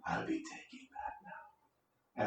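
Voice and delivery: creepy whisper right behind them; sinister whisper. Acted. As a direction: breathe it in their ear, slow and menacing.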